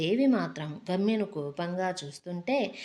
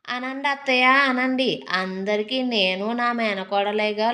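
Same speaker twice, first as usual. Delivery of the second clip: loud, higher sarcastic jabs; bitter mocking, voice raised; 4 semitones higher, 7 dB louder than usual. A narrator's reading. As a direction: sneer it back at her aunt, loud. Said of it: "దేవి వెటకారంగా".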